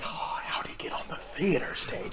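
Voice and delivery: hushed, breathy aside; a sneaky whispered quip; mock whisper. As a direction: whisper it like a puzzled onlooker. Mocking a puzzled onlooker.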